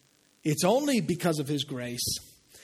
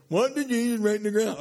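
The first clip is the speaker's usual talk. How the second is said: higher mock preacher voice; imitating his father; 5 semitones higher than usual. Son apologizing, then redoing dad's sermon line, imitating him. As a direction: put on dad's preaching accent. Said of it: "how you normally imitate your father".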